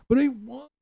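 put-on high, whining kid voice; whiny toddler. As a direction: pout like a toddler denied McDonald's. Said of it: "if you've ever been around a toddler".

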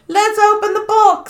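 high-pitched, botched attempt at an American accent; wrong American accent. Americans eager at the box, wrong accent for American. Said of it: "that was the wrong accent for the American".

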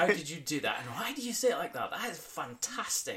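high, fast delighted gush; excited gushing. Retold or imitated. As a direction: gush like a thrilled fan. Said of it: "I just wanna copy them".

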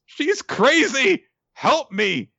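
high, loud, panicked plea for help; mock panicked cry. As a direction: squeal it out like a terrified victim. Victim cries for help.

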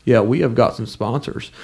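normal talking voice

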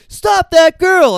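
high, loud, slow call; acted commanding shout; Gene Kelly's shout. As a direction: call out to a crowd, drawn out. Acting Gene Kelly calling out.

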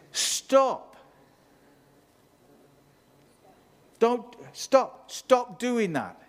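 high, loud stern stop; Paul scolding. Retold or imitated. Paul the Apostle scolding the noisy church in Corinth, loud stern command. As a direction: shout them down like an exasperated apostle. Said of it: "And he says".

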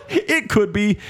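high squeaky laugh into the words. Real, not acted. Joking banter, laughing high.

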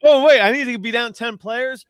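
high, giddy realization bursting into a whoop; mock glee. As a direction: act thrilled, building to a loud woohoo. Acts out his gleeful green light.

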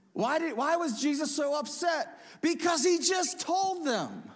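high, loud, pressing question; emphatic question. Real, not acted. Preacher asking the church why Jesus was upset, emphatic. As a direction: press the point hard.

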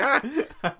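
short high mock scream; a silly masked-man shriek; mock clown scream. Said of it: "just being like".

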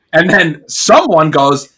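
ordinary talk

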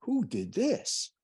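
slow, hushed, high-pitched wonder; awed question. Retold or imitated. Seeing the green landscapes for the first time, slow awed question. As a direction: ask it slowly, in awe. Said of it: "I said".

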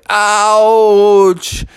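long, loud, high howl of pain; pained cry. Acted. Bubby lying in the bus after crashing, drawn-out wail. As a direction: stretch out a hammed-up ouch. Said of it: "Bubby was in some major pain".

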